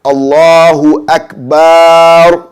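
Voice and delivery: Akbar with the bar held long, slow and sung out; wrong held bar. Shown as a demonstration. Teacher mimics the mistake for students, drawn out. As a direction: drag out the bar in Allahu Akbar. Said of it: "That would be wrong".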